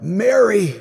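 heartfelt retold cry, high and drawn. Terrified man calling to Mary from his soul, crying out. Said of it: "I just cried out in my heart".

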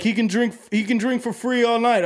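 put-on high-pitched Boston accent; Boston bouncer. Bouncer offering free drinks all night, Boston accent. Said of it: "it's so Boston".

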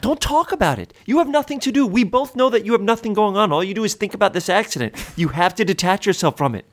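high, pressing scolding; exasperated pep talk. Acted. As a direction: urge him hard to move on. Heated lecture to friend.

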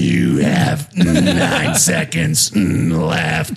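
very low, slow, laughing through it; slow deep voice breaking into a laugh; deep slow app voice. Pretend. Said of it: "He looks stressed out".

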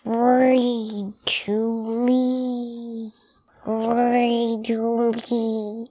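slow, gurgling monster voice; slow gargoyle voice. Shown as a demonstration. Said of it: "I tried to do it slow".

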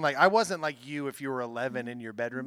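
ordinary talk, nothing marked

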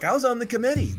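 high whiny put-on voice; mock whine. Owner reminds everyone of his committee seat, mock whine. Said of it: "then he basically whines".

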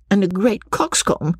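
high voice, falling to a close; Lucy's high-pitched verdict, ending on a fall; Lucy, dismissive. Acted. Lucy's remark, later echoed higher.

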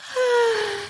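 short high-pitched moan. A narrator's reading. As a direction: let out a high little moan. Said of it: "Then we get a moan".

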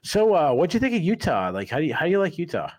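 high and loud, rushing the last question; mock a bubbly interviewer pushing her pet question; imitating eager reporter. Reporter asking every fighter about Utah, mimicked high and loud. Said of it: "she'd go".

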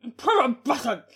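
put-on loud, high old-time announcer; hammed-up vintage shout; 1930s voice. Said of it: "nineteen thirties voice".

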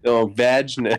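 "vagness" with a short A, like vadge-ness. Shown as a demonstration. Correcting friend on how the word was said. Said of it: "Did you just say vagueness".

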